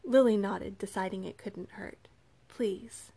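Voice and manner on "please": faint, whispered request; whispered plea. A narrator's reading. Weak girl accepts being carried.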